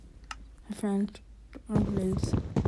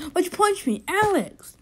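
much higher, louder outraged cry; indignant yell; 9 semitones higher, 4 dB louder than usual. Real, not acted. Player yelling at Alex for punching him. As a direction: call out the friend who hit you.

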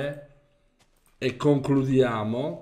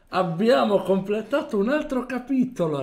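excited; 9 semitones higher, 6 dB louder than usual. Real, not acted. Celebrating finished chapter.

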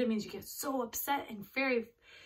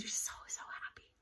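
whisper; 8 dB quieter, faster than usual. Real, not acted. Whispered explanation to kids.

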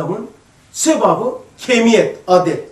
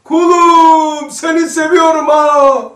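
booming call, higher and much louder, slowing at the end; shouting from the clouds; 7 semitones higher, 10 dB louder, much slower than usual. Pretend. Preacher voicing God calling Ömer from the clouds, booming shout. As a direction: call down from the sky like God addressing his servant. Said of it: "Buluttan mı bağırsın?".

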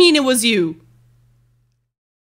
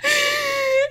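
high-pitched giggly apology; laughing apology; 9 semitones higher than usual. Real, not acted. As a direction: apologize through helpless laughter. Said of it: "I was laughing too hard".

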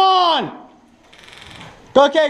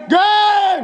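high excited shout; 6 semitones higher than usual. Real, not acted. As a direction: yell it out in relief. Match just ended.

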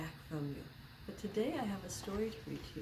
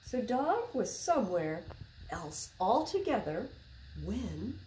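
put-on reading to children; 3 semitones higher, 6 dB louder than usual. A narrator's reading. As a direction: read it like story time for little kids. Reading a picture book aloud.

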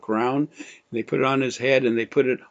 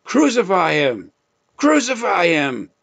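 raised, higher voice for the crowd's demand; crowd crying out; 7 semitones higher, 5 dB louder than usual. Retold or imitated. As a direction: call it out like the crowd, but held back. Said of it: "they cried out".

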